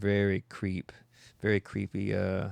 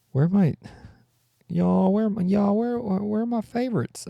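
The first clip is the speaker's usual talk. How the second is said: much higher, lost and confused; 11 semitones higher, 4 dB louder than usual. Pretend. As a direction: squeak out like a lost creature. Confused high voice.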